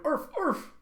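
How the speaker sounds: high, yelping mock barks; two high yaps; imitated dog bark. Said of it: "His band is going".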